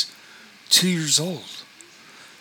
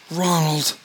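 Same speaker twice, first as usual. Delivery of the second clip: a mother's mild wail, higher; 4 semitones higher than usual. A narrator's reading. Mother to her son, a mild wail. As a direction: cry out his name in grief. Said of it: "wailed his mother".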